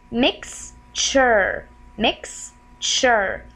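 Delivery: "mixture" in two parts, "mix", gap, "chur"; "mixture" sounded out slowly for learners; slow syllable split. Shown as a demonstration. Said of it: "pronounce it in a slow way".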